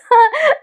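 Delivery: short high-pitched laugh; amused laugh. Real, not acted. Reviewer laughing at her own misread line, amused laugh. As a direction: burst out laughing at your own slip.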